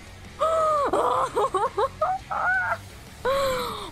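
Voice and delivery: long, high shuddering gasps; overwhelmed gasps. Real, not acted. Player just survived the boss, high shuddering gasps. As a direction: gasp wildly as the adrenaline hits. Overwhelmed after winning the fight.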